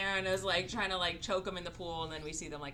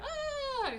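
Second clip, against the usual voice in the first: mock startled gasp. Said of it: "And she's like".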